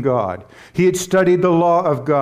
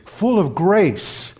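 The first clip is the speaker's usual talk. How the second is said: Full of grace drawn out slowly, stress on full; slow emphasis; 4 semitones higher, much slower than usual. Shown as a demonstration. Preacher answering his own question to the congregation, slow and stressed. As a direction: lean on full and let grace land.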